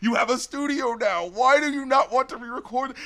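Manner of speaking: high, incredulous pleading; infuriated appeal to the artist; exasperated. Acted. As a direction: stretch out "dude", then plead. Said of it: "you know what's infuriating".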